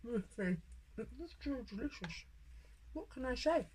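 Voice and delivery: soft words round a mouthful of food; real eating-while-talking, muffled by food; mouth full. Real, not acted. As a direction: talk while still chewing, savouring it. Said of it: "talking with my mouth full".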